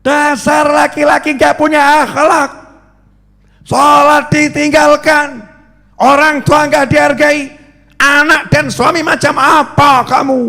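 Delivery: angry scolding. Retold or imitated. Weak wife shouting at her husband, angry. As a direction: yell at the husband in outrage.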